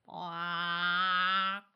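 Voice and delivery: duck-like quack; chicken quack. Acted. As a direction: quack like a bird.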